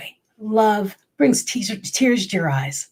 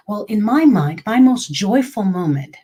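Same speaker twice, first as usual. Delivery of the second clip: ordinary talk, nothing marked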